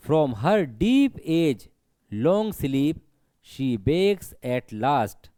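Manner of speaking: each word drawn out, very slow; slow poem recitation. Shown as a demonstration. Teacher reciting a poem line to students, very slow. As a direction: read the line out slowly for the class.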